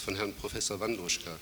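normal talking voice

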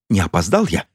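ordinary delivery

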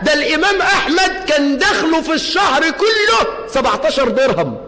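rousing sermon cry; preaching at full force. Real, not acted. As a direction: drive the point home to the congregation.

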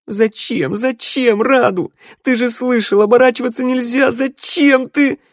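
high, loud, wailing demand; anguished reproach. A narrator's reading. Sanda blames Radu for turning back, wailing in anguish. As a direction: cry out the accusation, pitch climbing.

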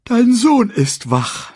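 high, loud, drawn-out sleepy voice; sleepy lioness. A narrator's reading. Woken mother tells her husband, sleepy drawn-out complaint. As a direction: drawl it sleepily, starting high. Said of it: "Verschlafen öffnete Sarabi die Augen".

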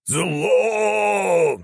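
high, long-held wail; fanatic's drawn-out high cry; howling. A narrator's reading. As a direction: howl the word out high. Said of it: "взвыл Святогор".

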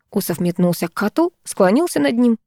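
ordinary delivery

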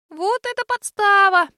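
high little squeak, slowed; tiny squeaking voice; squeaky voice. A narrator's reading. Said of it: "пропищало оно".

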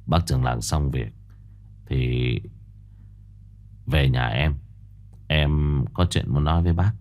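low, soft, confidential; quietly asking the elder to come by; said softly. A narrator's reading. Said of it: "khẽ nói". (nói khẽ, trầm và nhỏ)

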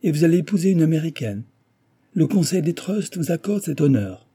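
high-pitched scorn, quick at first; contemptuous. A narrator's reading. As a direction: sneer at a rival's good fortune. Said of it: "reprit l'ingénieur avec mépris".

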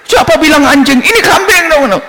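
very high-pitched indignant outburst; high, exasperated comic fury; acted outrage. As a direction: protest at the top of your range. Buyer snaps at third con man.

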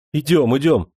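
loud, insistent hurry; urgent shout. A narrator's reading. Officer urges the priest to come, urgent shout. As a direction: drag him along shouting. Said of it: "закричал Демидов".

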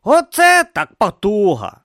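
high, slow voiced character. A narrator's reading. A character marveling at the sight, voiced high. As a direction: lift the voice high and let it hang. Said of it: "зронив він".

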